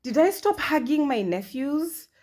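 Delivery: high, loud dawning alarm; suddenly realizing she stopped hugging them; shocked realization. Retold or imitated. Aunt realizing she stopped hugging her nephews, alarmed. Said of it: "and I'm like".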